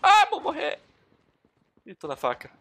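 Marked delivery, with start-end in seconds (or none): 0.0-0.8 very high, loud wail; high-pitched gamer panic; panicked squeal. Real, not acted. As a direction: squeal it as if about to be killed. About to die in game.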